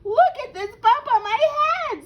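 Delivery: high, loud hen voice; high storybook hen protest; insistent hen. A narrator's reading. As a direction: insist at a high pitch, loudly. Hen insists sky is falling.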